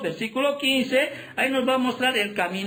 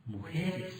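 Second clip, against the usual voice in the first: a near-whispered, much softer "Mujeres." after a long pause; hushed reveal; 5 semitones lower, 12 dB quieter than usual. Acted. Preacher to the congregation, revealing the answer in a hush. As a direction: drop the voice to almost nothing on "Mujeres.".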